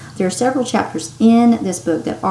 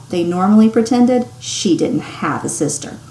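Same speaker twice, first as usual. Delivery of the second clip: ordinary delivery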